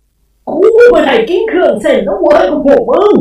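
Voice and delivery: high, loud, slow spirit roar; furious shout. A narrator's reading. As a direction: bellow in fury at the family. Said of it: "เจ้าพ่อตะโกนลั่นบ้าน". (ตะโกนลั่นด้วยความโกรธ)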